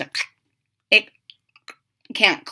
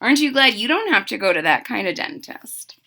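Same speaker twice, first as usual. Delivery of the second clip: usual speaking voice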